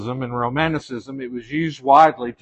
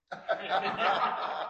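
laugh; 7 semitones higher, 11 dB quieter than usual. Real, not acted. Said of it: "laughing at the story".

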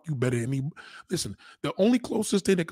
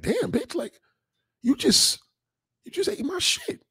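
much higher mock amazed cry; hammed-up high astonishment; mock shocked exclamation; slower than usual.

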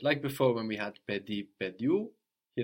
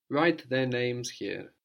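Write their names here again slowly, a bit lighter; slow repeat; slower than usual. Shown as a demonstration. Teacher repeating the prompt, slow and clear.

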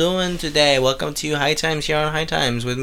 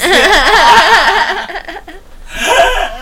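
high, giggling; laughing; 8 dB louder than usual. Real, not acted. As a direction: squeal it through a laugh.

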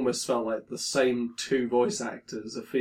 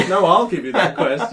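louder, laughing copy of the NPC; same NPC voice, louder; 6 semitones higher, 11 dB louder than usual. Retold or imitated. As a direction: repeat the line as a second identical NPC. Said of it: "the same voice the whole way".

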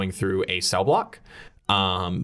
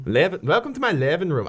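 raised Irish lilt, practising; attempted Irish accent; 6 semitones higher than usual. Shown as a demonstration. Player getting into the character's voice, attempted Irish accent. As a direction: ease into a put-on Irish accent. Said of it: "like Scottish or Irish".

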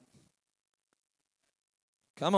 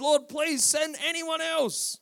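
higher, slow pleading plea; pleading Moses; 8 semitones higher than usual. Retold or imitated. Moses begging the Lord to send someone else, slow and pleading. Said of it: "Moses again pleaded".

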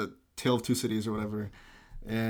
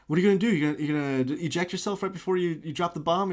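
higher-pitched mock alarm; imitated worried sidekick, pitched up; retold urgent questioning; 6 semitones higher, 3 dB louder than usual. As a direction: fire anxious questions, play-acting. Retold policeman questioning pilot.

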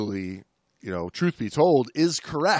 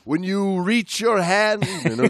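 slow, hammed-up spooky warning with a laugh; mock-ominous; 7 semitones higher, 7 dB louder, slower than usual. Host warning his co-host about morays, hammed-up spooky. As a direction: tell it like a campfire scare.

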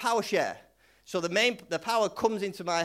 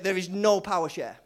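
ordinary talk, nothing marked

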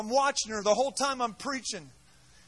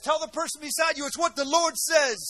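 shouting fiery sermon to the crowd; rally the congregation, loud and rousing; preaching at full force; 3 semitones higher, 9 dB louder than usual. Real, not acted. Preacher rousing his congregation, fiery shout.

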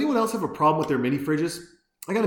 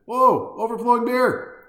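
high, loud comic alarm; mock panic; 5 semitones higher, 5 dB louder than usual. Real, not acted. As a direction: overdramatic dismay at spilling beer. Beer overflowing, mock panic.

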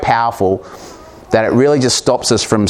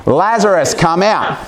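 "Lazarus, come out" called out much higher and louder; 8 semitones higher than usual. Shown as a demonstration. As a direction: call him out of the tomb at full voice. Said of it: "Let's do it again".

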